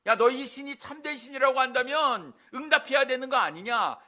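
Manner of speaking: loud, high-pitched taunt; mocking challenge. Elijah taunting prophets about their silent god, mocking. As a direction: throw it out as a loud jeer. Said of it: "엘리야 선지자가 이렇게 이야기한".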